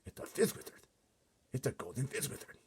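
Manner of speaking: rising breathless delight; thrilled. A narrator's reading. Giant realizes it's a rare dream, thrilled. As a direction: build up the wonder. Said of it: "with a thrill in his voice".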